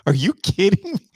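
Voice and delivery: high, loud disbelief; incredulous. Retold or imitated. Acting his shock at others' kids.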